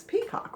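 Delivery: "Peacock" with long E, pitched high; correct reading. Shown as a demonstration. Teacher confirms right reading of "Peacock". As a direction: land on "Peacock" brightly. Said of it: "which makes sense".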